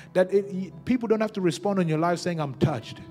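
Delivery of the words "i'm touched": ordinary delivery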